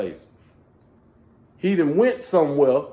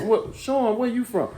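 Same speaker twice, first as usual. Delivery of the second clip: retold question; 9 semitones higher than usual. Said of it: "Then he goes".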